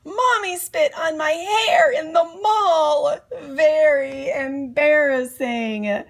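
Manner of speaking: high, loud hammed-up kid wail; yelling. [name] protests about spit in the mall, loud mock wail. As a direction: wail it like a disgusted boy. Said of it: "yelled [name]".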